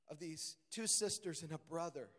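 ordinary talk, nothing marked

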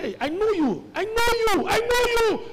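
high, insistent accusing woman; a servant girl pressing her accusation. Acted.

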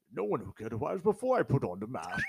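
exaggerated Bane impression with British accent; mock Bane. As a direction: do a mocking, Connery-like masked villain. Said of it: "is this Bane or fucking Sean Connery?".

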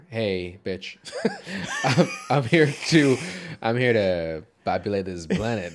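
slow, drawn-out line broken by laughter; mock come-on. Host jokes the rescuer came to populate the planet, laughing mock come-on. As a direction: play a suggestive greeting and crack up.

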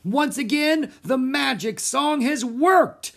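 high, drawn-out stage announcement; triumphant shout. A narrator's reading. Magician announces the trick to the crowd, shouted. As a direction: shout it out slowly to a whole theater. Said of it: "she shouted".

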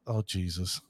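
usual speaking voice

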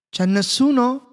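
loud, high call into the empty hall. A narrator's reading. Maurice calling into the empty castle, loud and high. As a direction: hail the dark castle.

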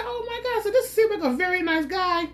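high, squealing delight; high mock gush. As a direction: swoon over the nice guy. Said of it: "I said".

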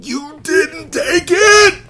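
long, high, slow shout; anguished shout. Acted. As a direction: cry it out at the top of the voice. Breeze shouts to comfort Mia.

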